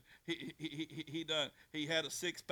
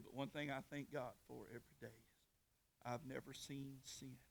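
softer, tender confession; quiet sincere; 3 semitones lower, 9 dB quieter than usual. Retold or imitated. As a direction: drop it low and heartfelt. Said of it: "and then he said".

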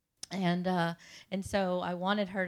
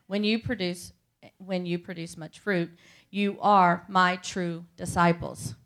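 scripture reading; 5 dB louder than usual. Acted. Reads scripture aloud.

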